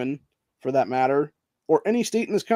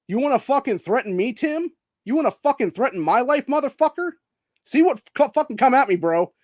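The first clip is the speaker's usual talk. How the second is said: real furious taunt, pitched higher; angry challenge; 6 semitones higher than usual. Real, not acted. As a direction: dare him to come at you, heated. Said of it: "why I'm fucking pissed".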